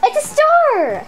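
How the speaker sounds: mocking sing-song. Sister teasing her brother in the star-or-bow squabble, slow and sing-song. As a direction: drag it out to mock him.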